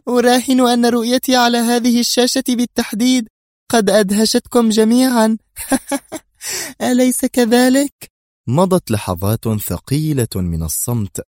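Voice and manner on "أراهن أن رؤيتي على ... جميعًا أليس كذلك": high, taunting female leader voice; sneering leader with a laugh; mocking villainess. A narrator's reading. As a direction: gloat at the stunned officials. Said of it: "في سخرية".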